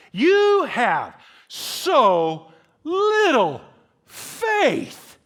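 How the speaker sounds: loud, high, slow and drawn out; yelled rebuke. Acted. Jesus rebukes disciples over the storm, yelling. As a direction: yell each word slowly over a storm. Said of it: "he's gotta yell this".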